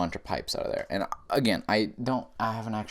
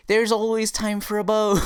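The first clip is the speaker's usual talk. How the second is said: high, loud Irish elf voice; hammed-up Irish accent, pitched way up; attempted Irish accent; 13 semitones higher, 7 dB louder than usual. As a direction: squeal it as an over-eager Irish elf. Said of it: "if I ever try to do an Irish accent".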